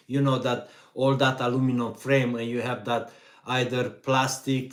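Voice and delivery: ordinary talk, nothing marked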